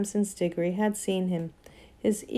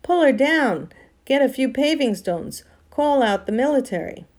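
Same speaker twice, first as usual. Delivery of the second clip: raised, indignant calls from a mob; angry crowd shouts; 5 semitones higher, 5 dB louder than usual. A narrator's reading. Angry crowd turning on the witch, indignant shouts. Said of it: "came an angry roar from the crowd".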